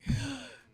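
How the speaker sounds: sharp, high, loud gasp of awe; starstruck gasp. Retold or imitated. Coach retelling meeting her idol, gasps high and loud. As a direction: gasp like you just saw your idol. Starstruck seeing Pat Summitt.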